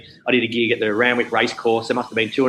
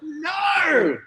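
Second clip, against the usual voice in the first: much higher mock-horrified protest. Comedian told he's on after the tribute montage, much higher horrified no. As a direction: recoil in comic dismay. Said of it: "I'm like".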